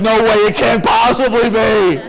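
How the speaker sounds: slow, high comic holler; shouted disbelief. Retold or imitated. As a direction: belt it out, stretching every word. Brain protests in disbelief.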